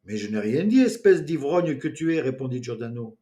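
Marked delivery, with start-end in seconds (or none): 0.1-2.2 defiant. A narrator's reading. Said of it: "en forme de défi".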